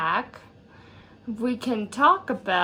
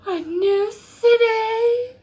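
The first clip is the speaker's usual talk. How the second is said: high, slow, drawn-out exclamation; mock awe; 10 semitones higher, 3 dB louder, slower than usual. Narrator hamming up the move to a new city, mock awe. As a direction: play up the wonder, stretched high.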